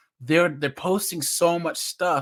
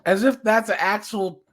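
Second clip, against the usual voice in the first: higher and louder, incredulous; exasperated disbelief; 6 semitones higher, 5 dB louder than usual. Real, not acted. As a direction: come on, really, can't believe this guy. Mocking a predator's lame excuse.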